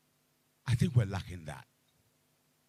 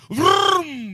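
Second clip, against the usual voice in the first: very high, loud "vroom" like a revving car; loud imitation of a car taking off; 18 dB louder than usual. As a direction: rev off like a car speeding away. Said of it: "the car goes off".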